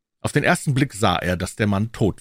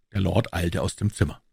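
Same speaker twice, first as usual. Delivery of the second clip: ordinary delivery, nothing marked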